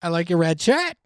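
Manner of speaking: put-on Kiwi accent with questioning upswing; mock New Zealand lilt. Shown as a demonstration. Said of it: "your best New Zealand accent".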